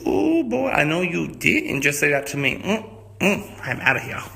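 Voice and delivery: offended outburst. Acted. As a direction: snap back insulted and leave. Dame offended, storms off.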